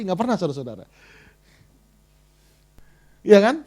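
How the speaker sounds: usual speaking voice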